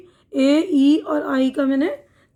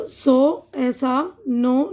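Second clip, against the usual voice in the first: slow long o demo; much slower than usual. Shown as a demonstration. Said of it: "ओ का तलफ़ुज़ जो होता है ओ".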